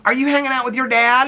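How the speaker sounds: high sing-song pet greeting. Retold or imitated. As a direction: coo a greeting to a little dog. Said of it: "what she would say".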